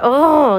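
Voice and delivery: loud, high "oh" of exasperation; frustrated groan. Retold or imitated. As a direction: groan it out, fed up. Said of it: "I was real frustrated".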